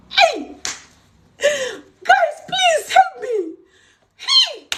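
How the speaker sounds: high, drawn-out wail of exasperation; exasperated cry for help. Real, not acted. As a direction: shout out a desperate plea. Exasperated mock plea for help.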